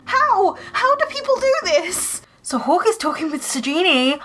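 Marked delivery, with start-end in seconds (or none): 0.0-2.2 amazed disbelief. Real, not acted. Amazed at the Sims build.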